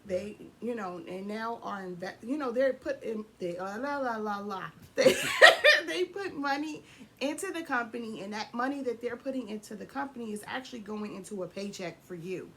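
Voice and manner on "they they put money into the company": laughing at her own stumble; giggling through the line. Real, not acted. Laughs after flubbing words.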